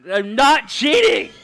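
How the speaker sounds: slow, loud exasperated protest; exasperated yell. Real, not acted. Streamer denying cheating to chat, slow yell. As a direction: drag it out in frustration.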